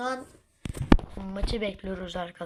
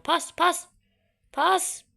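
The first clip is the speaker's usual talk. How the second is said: loud, higher urgent call; 6 semitones higher, 6 dB louder, much slower than usual. Real, not acted. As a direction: yell for the pass. Calling for a pass in game.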